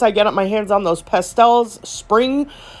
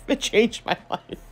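laughing through it; 7 dB quieter than usual. Real, not acted. Laughs at her own repetition.

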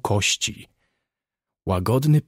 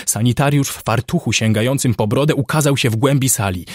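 usual speaking voice

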